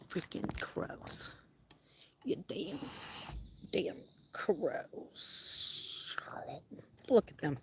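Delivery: slow, hushed curse at the crows; whisper. Real, not acted. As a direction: grumble it under your breath. Grumbling at crows laughing at him.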